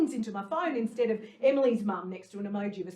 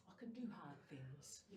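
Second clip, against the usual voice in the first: soft, low, near-murmured mantra; quiet mantra; 8 semitones lower, 22 dB quieter than usual. Acted. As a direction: close the piece on a hushed mantra.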